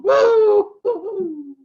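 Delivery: high, loud cheer; joyful whoop. Acted. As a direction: let out a whoop. Cheer of joy.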